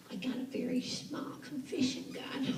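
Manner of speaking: unvoiced, hushed whisper; whispered confession. Old woman whispering her confession to God, hushed. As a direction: lean in and whisper it like a secret.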